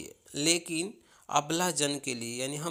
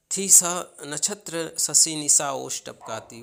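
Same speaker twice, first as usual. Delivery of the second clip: poem recitation; 5 dB louder than usual. Acted. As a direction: launch the line with a raised voice. Recites verse line, loud start.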